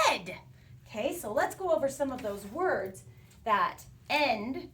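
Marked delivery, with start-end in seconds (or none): 0.1-0.3 yelled ending. Shown as a demonstration. Teacher showing the E D ending, yelled out. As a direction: shout the ending like the storybook dad. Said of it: "his dad liked to yell his name".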